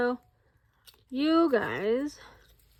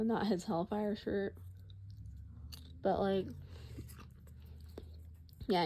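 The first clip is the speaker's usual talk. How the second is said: ordinary delivery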